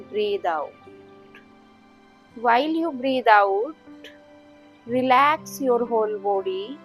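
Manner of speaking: normal talking voice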